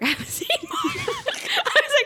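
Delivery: high squeal through laughter; laughing. Retold or imitated. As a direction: crack up while quoting yourself. Laughs through her retold reply.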